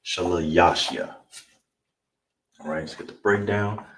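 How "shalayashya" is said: shalayashya said slowly, syllable by syllable; Hebrew word for third. Shown as a demonstration. Teacher models the Hebrew word slowly for students. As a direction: sound out shalayashya carefully. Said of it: "in the Hebrew is shalayashya".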